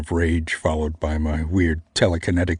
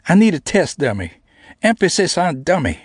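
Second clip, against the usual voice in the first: put-on high, louder teasing girl; 12 semitones higher, 3 dB louder than usual. A narrator's reading. Namie needling him as a test dummy, high and teasing.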